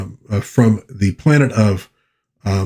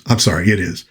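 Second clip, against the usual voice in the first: ordinary delivery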